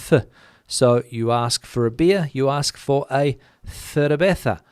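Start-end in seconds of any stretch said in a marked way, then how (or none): 0.1-0.2 th sounded alone after a short pause; isolated th sound. Shown as a demonstration. Teacher explains mainland Spanish, th sounded alone. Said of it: "it is pronounced as a". 3.7-4.6 cerveza with th for C and Z, "thervetha"; Castilian th. Shown as a demonstration. Teacher orders a beer as example, cerveza with th. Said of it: "because the Z".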